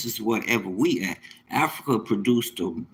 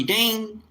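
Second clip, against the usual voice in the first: imitated phone ding; 11 semitones higher than usual. Payment notification sound.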